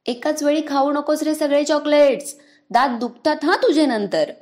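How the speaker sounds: half-laughing warning shouted after him; calling after him. Acted. Sister calling after her brother running with chocolates, loud teasing warning. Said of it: "ओरडून सांगितले".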